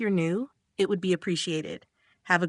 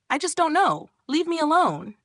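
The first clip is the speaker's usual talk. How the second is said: higher, pleading near-crying shout; tearful yell; 9 semitones higher than usual. A narrator's reading. Flustered guy near tears begs the friend to stop. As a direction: beg to be left alone, almost crying. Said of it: "He begins almost crying and stutters, yelling".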